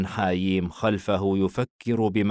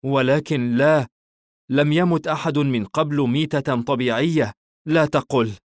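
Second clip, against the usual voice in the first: higher, louder, anxious protest; worried interruption; 6 semitones higher, 4 dB louder than usual. A narrator's reading. Hayeem interrupts the father's talk of dying, in a worried tone. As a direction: cut in with worry, voice raised in pitch. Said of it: "مقاطعا وبنبرة قلق يقول".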